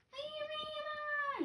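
very high and soft, bewildered; a small lost chick; baby bird voice, lost. Acted. Baby bird asking where it is, high and soft. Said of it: "my baby bird voice".

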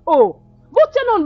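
heated scolding. Acted. Friend scolds Lisa over reckless marriage.

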